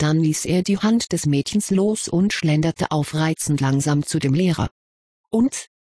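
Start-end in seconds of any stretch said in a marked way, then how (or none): 5.3-5.7 cheeky rising "Und?"; a cocky challenge to the teacher. A narrator's reading. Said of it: "fragte er Snape frech". (frech, hoch gefragt)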